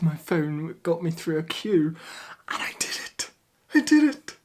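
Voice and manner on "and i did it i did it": triumphant. Real, not acted. Vlogger who just got a PlayStation five, triumphant. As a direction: whisper then a high loud shout of triumph. Won PS5 queue.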